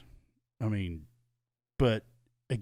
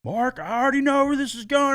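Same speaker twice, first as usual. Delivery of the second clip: high, loud mock sister voice, already knowing; 12 semitones higher, 6 dB louder than usual. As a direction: play the worried sister, pitched high and louder. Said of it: "my sister's like".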